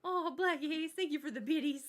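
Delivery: thrilled thanks to the viewer; excited thanks. Real, not acted. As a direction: belt out the gratitude. Thanking for gift.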